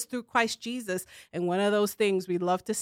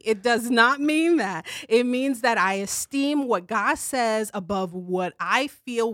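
ordinary talk

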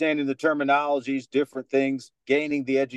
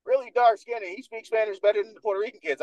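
put-on higher, amazed kid teasing; mocking schoolkids; 7 semitones higher than usual. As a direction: imitate kids marvelling at him. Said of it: "it's like".

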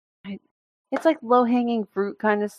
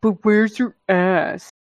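slow, mocking taunt; slow echo of the rude comment; mocking quote; 2 semitones higher, much slower than usual. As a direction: drag the dumb question out.

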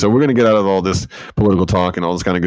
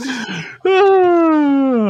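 exaggerated sigh. Real, not acted. Sigh after bad pun.

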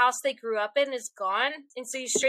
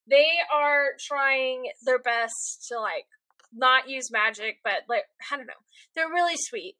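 ordinary delivery

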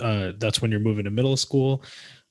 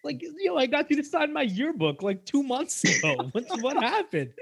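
much higher, pleading and half laughing; high-pitched hurt pleading; 12 semitones higher than usual. Retold or imitated. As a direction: plead with your old friend in a high voice. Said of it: "It's like".

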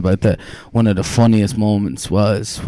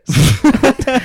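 loud high laughter; 4 dB louder than usual. Real, not acted. Laugh after the quote.

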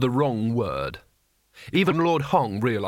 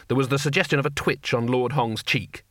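ordinary delivery, nothing marked

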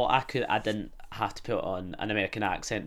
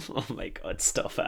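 ordinary talk

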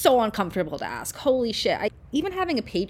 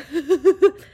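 teary laugh; 11 semitones higher, 11 dB louder than usual. Real, not acted. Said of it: "crying right now".

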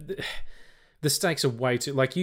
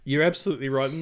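ordinary delivery

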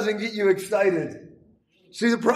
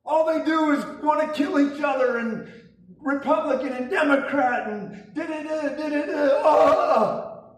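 much higher, hammed-up fearful wail; mock panic; 6 semitones higher than usual. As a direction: play a frantic doom-scroller, mockingly. Mocking a panicked news watcher.

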